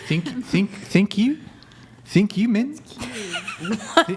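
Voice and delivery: hammed-up Kiwi thank-you; Kiwi accent. Shown as a demonstration. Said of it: "can anybody do a Kiwi accent?".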